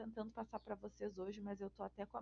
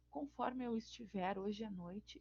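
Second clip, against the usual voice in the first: usual speaking voice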